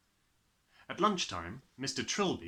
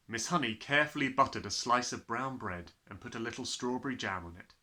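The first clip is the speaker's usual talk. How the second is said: plain reading voice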